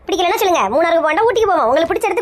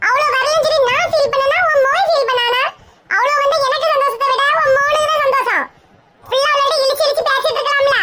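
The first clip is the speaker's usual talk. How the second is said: high mocking nagging; 7 semitones higher than usual. Mother argues, mocking son's girl.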